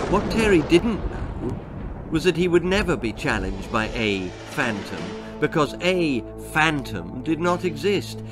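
ordinary delivery, nothing marked

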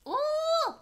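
high, loud drawn-out "ooh"; big high exclamation. Real, not acted. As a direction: whoop a long ooh. Reaction during gameplay.

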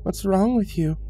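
put-on high child's voice; calling ghost child. A narrator's reading. Second dead child challenges the visitor, calling high. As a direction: call out pitched well up as a child. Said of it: "it called".

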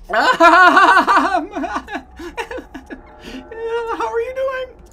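manic high laugh into squeaky greeting; extremely high-pitched crazed mascot; cackle, then squeak the question; happy buddy. Acted.

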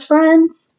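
normal talking voice

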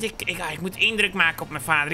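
ordinary delivery

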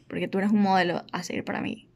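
ordinary talk

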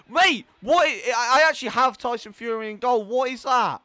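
high, loud exasperated complaint; loud disbelief. Real, not acted. Gamer with Tyson Fury in goal, loud disbelief. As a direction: protest loudly in disbelief. Complaining loudly about his goalkeeper.

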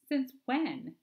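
high, loud mocking sneer. A narrator's reading. Mike mocks his vegetarian sister, high sneering jab. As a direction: jeer it at the sister. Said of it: "Mike sneered".

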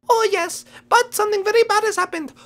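very high little creature voice; rabbit bearing bad news.

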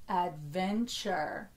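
"Adventure" drawn out to feel each syllable. Shown as a demonstration. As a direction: say "Adventure" slowly, in connected syllables. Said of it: "say it a little slower".

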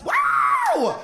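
a squealing, very high wow; high excited shriek. Acted. Hyping the crowd, loud high shriek. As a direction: shriek with glee.

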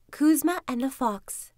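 ordinary delivery

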